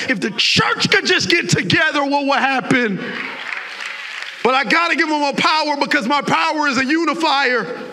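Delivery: high, loud preaching; climbing sermon cry; full-force preaching. Real, not acted. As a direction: lift the church with it.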